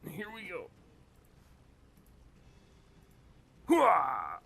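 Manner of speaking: loud battle cry hooah; gung-ho soldier. Acted. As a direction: shout it like a soldier charging. Gung-ho shout before shot.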